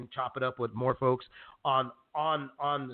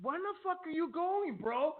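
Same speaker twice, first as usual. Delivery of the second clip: mock yelling; 13 semitones higher, 6 dB quieter than usual. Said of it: "I would have been yelling at you".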